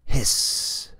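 long unvoiced hiss like a snake; a hissing snake sound for the kids. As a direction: hiss it out through the teeth. Imitating a snake noise.

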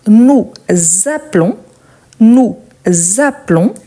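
"nous ... appelons" in separate pieces, a z opening "appelons"; demonstrated liaison. Shown as a demonstration. Teacher models the conjugation for learners, demonstrated liaison. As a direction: pause after "nous", then z-linked "appelons" rising. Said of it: "je fais la liaison automatique".

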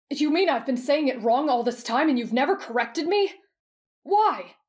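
aghast, pitched high; horrified disbelief. A narrator's reading. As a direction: demand an explanation, mortified, voice rising high. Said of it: "His mouth hung agape in horror".

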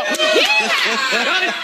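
very high, drawn-out cowboy whoop; soaring, high-pitched whoop; rallying yeehaw. Shown as a demonstration. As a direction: lead the crowd in a long yeehaw. Second rallying demonstration of yeehaw.

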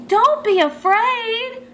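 drawn-out high call. A narrator's reading. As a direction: call out gently to a fleeing fish. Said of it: "Chomp called out".

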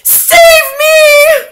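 very high, loud cry for help. A narrator's reading. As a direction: scream for mercy in terror. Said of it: "cried the wretched witch".

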